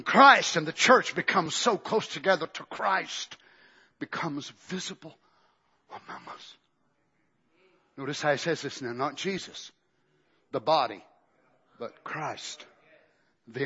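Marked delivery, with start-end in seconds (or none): 4.0-9.7 soft trailing whisper; whispered reverence; hushed whisper. Real, not acted. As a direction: let it fall to a breath. Dropping to a whisper.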